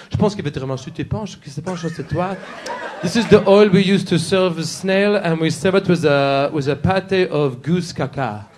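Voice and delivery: mock French waiter reciting specials; softly hammed-up French accent. Waiter giving the specials at a French restaurant, mock French accent. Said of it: "Go to a French restaurant".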